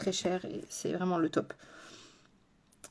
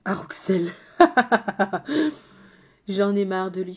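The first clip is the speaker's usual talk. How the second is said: ordinary delivery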